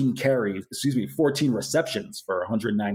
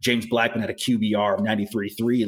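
ordinary talk

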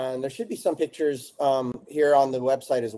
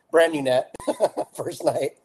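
laughing; 3 semitones higher than usual. Real, not acted. Laughing at the lost net.

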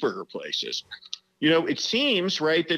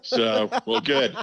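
words said through a real laugh, higher; 7 semitones higher, slower than usual. Real, not acted. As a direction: still chuckling as you speak. Guest laughing after the joke.

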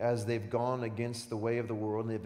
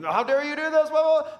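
high, loud mock outrage; hammed-up online ranting; 13 dB louder than usual. Preacher mocking angry social media posters, loud high rant. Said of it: "rant on social media".

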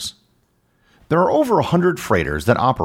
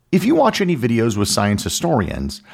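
ordinary talk, nothing marked

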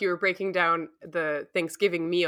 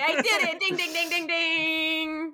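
high, ringing game-show bell dings; playful celebration; 10 semitones higher than usual. Pretend. Guest celebrates guessing the right answer, playful celebration. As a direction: chant the dings like a winner's bell.

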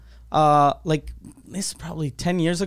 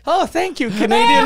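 high-pitched, put-on delighted thanks; mock gratitude; 11 semitones higher, 6 dB louder than usual. Fleeing customer thanks the mystery Canadian, mock gratitude. Feigned gratitude to unknown buyer, retold.

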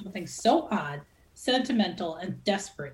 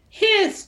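loud, high playful Hiss; hammed-up hiss; 13 semitones higher, 8 dB louder than usual. A narrator's reading. Ivelisse chiming in against the rival, loud high mock hiss. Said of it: "chimed Ivelisse".

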